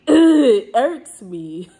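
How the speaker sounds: slow, loud laugh through the words; real exasperated laugh; laughing irritation. Real, not acted. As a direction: laugh out your annoyance. Venting, laughing in frustration.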